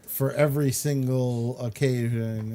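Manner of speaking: ordinary delivery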